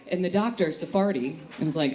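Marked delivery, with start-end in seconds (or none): none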